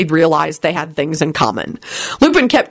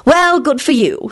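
rude; 9 semitones higher, slower than usual. Acted. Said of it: "she replied rudely".

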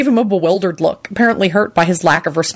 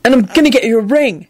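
determined; 6 semitones higher than usual. Acted. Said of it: "he pronounced determinedly".

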